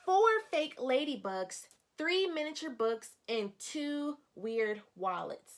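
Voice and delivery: expressive picture-book narration for a class; storybook read-aloud. Acted. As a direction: lively, clear reading to young children. Teacher reads picture book aloud.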